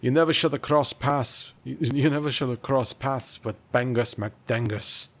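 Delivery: hammed-up Scottish warning; Scottish accent. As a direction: play a boastful Scottish nemesis. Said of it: "I could probably try a bit of Scottish".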